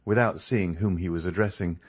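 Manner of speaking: ordinary delivery, nothing marked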